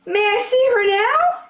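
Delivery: can't wait to see her; eager child. Acted. Franklin asking Granny, high and eager.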